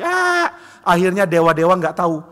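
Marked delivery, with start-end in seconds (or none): 0.0-0.5 high bleat in reply; sheep bleat. Acted. Another sheep answers the baby with a high bleat. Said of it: "Ada domba lagi".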